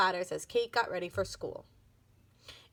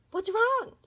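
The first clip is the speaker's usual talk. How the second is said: alarmed; 11 semitones higher, 5 dB louder than usual. A narrator's reading. Alarmed question after shriek.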